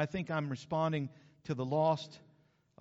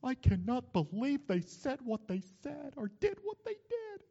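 exasperated disbelief; 8 semitones higher than usual. Retold or imitated. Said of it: "I said".

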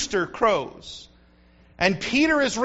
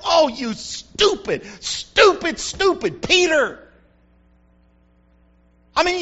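self-scolding; 5 semitones higher, 5 dB louder than usual. Acted. Peter scolds himself.

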